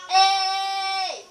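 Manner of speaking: sustained high yell; long loud shout. Real, not acted. Player calling out mid-game, long loud shout. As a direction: hold the word out at full voice.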